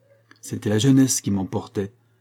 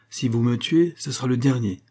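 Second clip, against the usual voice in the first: plain reading voice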